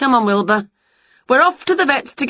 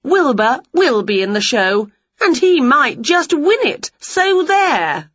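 defiant retort; 8 semitones higher, 4 dB louder than usual. A narrator's reading. Winnie's defiant comeback.

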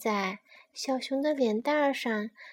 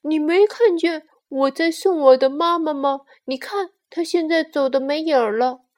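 loud, higher indignant protest; angry little bear; 3 semitones higher, 10 dB louder than usual. Acted. Bear seeing mama off is interrupted, angrily protesting. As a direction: scold as a cross bear cub. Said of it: "小熊生气地说".

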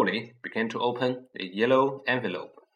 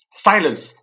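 loud, higher yell; 6 semitones higher, 11 dB louder than usual. A narrator's reading. Uncle Vernon yells at Harry for silence. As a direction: bellow it to end the argument. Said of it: "yelled Uncle Vernon".

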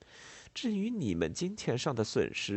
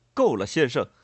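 loud, impatient interruption; acted exasperated outburst; out of patience; 8 dB louder than usual. A narrator's reading. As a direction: cut in loudly, patience gone. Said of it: "再也忍耐不住的列莫尔说道".

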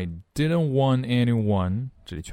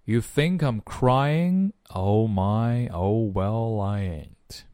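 slow, separated phrases with rising ends; follow-along model reading; much slower than usual. Shown as a demonstration. Teacher leading learners to repeat the lyrics, slow model reading. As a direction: model each line slowly for learners to copy. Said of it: "来，跟着[name]一起".

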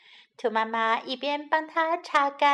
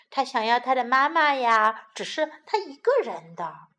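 ordinary delivery, nothing marked

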